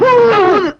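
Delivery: loud command. Acted. Man showing how to blow a pill into a cow, loudly. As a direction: punch out the order to blow.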